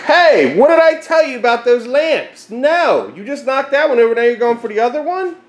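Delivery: high, sharp telling-off; scolding. Real, not acted. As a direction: scold him for the lamps. Scolding the dog about lamps.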